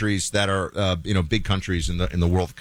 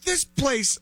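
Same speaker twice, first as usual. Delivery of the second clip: quoted tourist. Retold or imitated. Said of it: "they don't sound like this".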